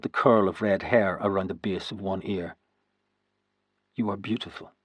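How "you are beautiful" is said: soft, hushed inner voice; quiet private thought. A narrator's reading. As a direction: say it to yourself, awed. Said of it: "I thought".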